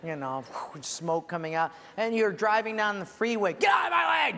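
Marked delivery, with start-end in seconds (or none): 3.5-4.4 high, loud mock yell at traffic; a hammed-up road rage shout at drivers. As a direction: bellow at the cars in your way.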